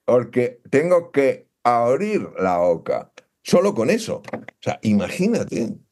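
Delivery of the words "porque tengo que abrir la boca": talking around a pencil in the mouth; pen in teeth. Shown as a demonstration. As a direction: mouth held open by a pencil. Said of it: "que me obliga a estar así".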